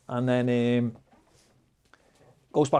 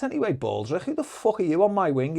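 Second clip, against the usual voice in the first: higher-pitched mock confrontation; retold cocky challenge; 8 semitones higher than usual. Prisoner challenging a stranger on his wing, cocky and high. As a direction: challenge him cheekily, voice lifting sharply.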